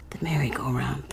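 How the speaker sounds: unvoiced, breathy whisper; whispered thought. A narrator's reading. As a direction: whisper it to yourself. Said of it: "thought Carney".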